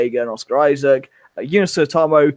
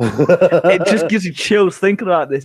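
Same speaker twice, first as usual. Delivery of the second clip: laughing excitedly; 5 semitones higher, 4 dB louder than usual. Real, not acted.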